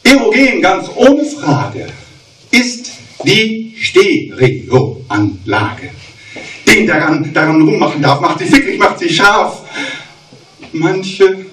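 rhythmic cabaret verse reading; mock-solemn poetry reading; poem recital. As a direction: recite the verse with comic timing.

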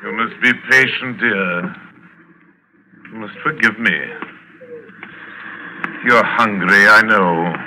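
low, soft and tender; calm the dog down gently; soothing. Acted. General soothes the hungry dog.

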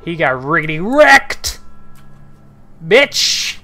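loud, rising jeer; gloating shout. Real, not acted. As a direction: crow loudly over the kill. Gloating over a game death.

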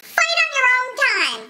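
high, slow shrieked complaint; high mock yell. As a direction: shriek it like a fed-up passenger. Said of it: "people screaming".

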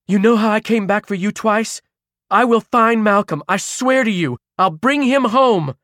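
high, loud, insistent vow; urgent promise. A narrator's reading. Caro gripping Ruby's shoulders, urgent promise. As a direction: swear it forcefully to get through. Caro swears to calm hysterical Ruby.

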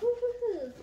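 high, sung-out little cheer; cheering over a new book; happy woo-hoo. Real, not acted. Cheering over the next book in the pile, high and bright.

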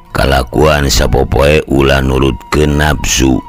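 usual speaking voice

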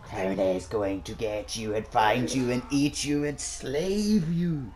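slow, taunting clown threat; creepy clown. Pretend. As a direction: menace them like a creepy clown. Menacing clown roleplay.

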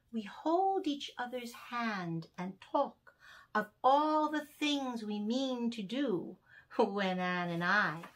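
lively, sing-song storytelling read; poem for children. Acted. Reading a Binker poem aloud to young children, sing-song. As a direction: bounce through the rhymes for little listeners. Reads poem about Anne.

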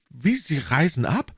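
taken aback, voice lifting; startled exclamation. Acted. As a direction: cry out in surprise as the young woman. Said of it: "rief Madame Bonacieux".

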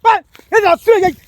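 high, agitated shouting; fly at him with repeated high-pitched challenges; real angry outburst. Real, not acted. Provoked man threatening to hit the pestering stranger, furious. Quarrel turns to threatened blows.

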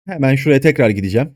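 normal talking voice

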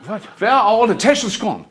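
high, loud mock disbelief; mayor finding the car park empty, high and loud; shocked. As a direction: shriek it in pretend panic at the empty car park. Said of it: "And she went".

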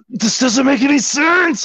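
high, stretched-out frustrated cry; retold exasperation. Pulling his hair over missing joints, high retold exasperation. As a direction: drag it out high, at your wits' end. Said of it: "I was, like, pulling my hair".

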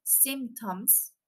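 "symptoms" said slowly as SIMP-toms; slow teacher's model of "symptoms", SIMP-toms; pronunciation demo. Shown as a demonstration. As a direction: draw out "symptoms" in two careful syllables. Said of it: "la palabra síntomas se pronuncia".

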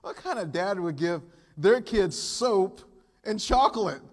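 can't believe what he's seeing; incredulous. Retold or imitated. Pastor reacting to the movie dad's gifts, loud and incredulous. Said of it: "And I said".

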